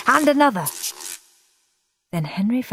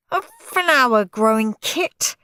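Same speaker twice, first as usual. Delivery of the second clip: howling; 6 semitones higher, 3 dB louder than usual. Acted. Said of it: "howled Stuck-up Steve".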